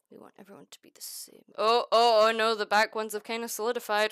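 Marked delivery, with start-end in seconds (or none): 0.1-1.4 fast, breathy whispered repeat. Shown as a demonstration. Streamer whispers to the beads lining up, whispering. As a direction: whisper it like a creepy secret.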